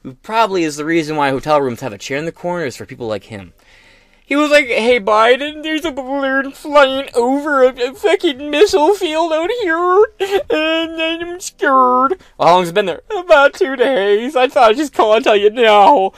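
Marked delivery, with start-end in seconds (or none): 4.2-4.7 mock high whine. Mocking governor's call. 4.7-12.2 much higher, timid whine, slow at the end; whiny scared governor. Retold or imitated. Governor phoning Biden, whining in a mock scared voice. 13.1-16.1 whiny scared governor. Retold or imitated. Governor phoning Biden, whining in a mock scared voice.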